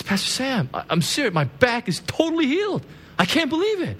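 high-pitched, rising astonishment; amazed student. Retold or imitated. Student amazed that she is healed.

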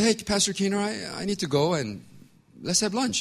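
high, bright-eyed call to a friend; eager greeting. Retold or imitated. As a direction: greet him up high, keen for lunch.

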